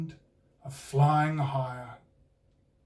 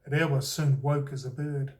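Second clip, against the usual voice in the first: usual speaking voice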